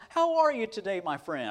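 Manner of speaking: high, loud greeting falling on "my friend"; hearty greeting. Retold or imitated. Professor greeting someone he never met, hearty and familiar. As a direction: hail them like a lifelong friend. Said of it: "you'd think he had known you all".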